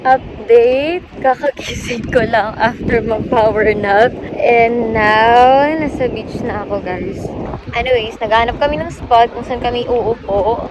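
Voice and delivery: ordinary talk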